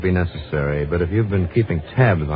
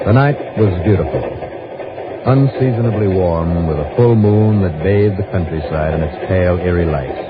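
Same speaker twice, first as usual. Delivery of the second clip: calm, even first-person detective narration; story narration; 3 semitones lower than usual. A narrator's reading. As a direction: tell it like a hard-boiled case report. Detective's narration over music.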